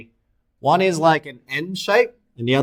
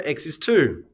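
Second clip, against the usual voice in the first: ordinary talk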